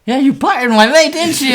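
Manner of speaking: high, loud accusing challenge; retold confrontation.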